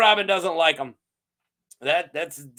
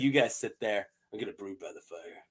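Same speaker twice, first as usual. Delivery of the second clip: lightly voiced brooding Lynn; 9 semitones lower, 10 dB quieter, faster than usual. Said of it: "He's like".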